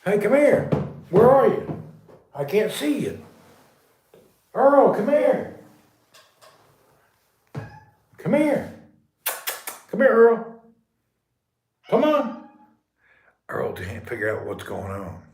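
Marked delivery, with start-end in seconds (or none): 0.1-1.8 high, loud, drawn-out call. Real, not acted. Coaxing his pet out of the dark, loud high calling. 4.5-12.5 high, loud coaxing call. Real, not acted. Coaxing his frightened pet over, high calling.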